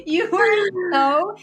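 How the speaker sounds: teasing. Real, not acted. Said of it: "teasing mom".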